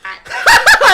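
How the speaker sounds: loud, high-pitched cackle; burst of laughter. Real, not acted. Streamer reacting to an absurd post, loud high laugh.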